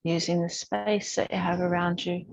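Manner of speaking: calm, unhurried instructor voice with long pauses; even, measured cues, leaving space to breathe; guided yoga meditation. Real, not acted. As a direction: gently guide a class through breath and movement. Leads breathing and gentle yoga.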